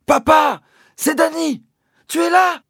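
high, loud calling out; boy shouting for his father in the woods, calling high and loud; desperate shout. Acted. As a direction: yell into the dark for Dad. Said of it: "j'ai crié".

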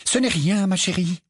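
hiding annoyance behind sweetness; exasperated. Acted. Mrs Wesley to Tonk, masking exasperation. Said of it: "visiblement exaspérée".

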